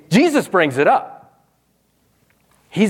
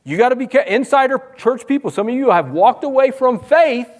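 ordinary talk, nothing marked

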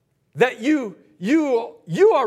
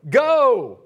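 normal talking voice

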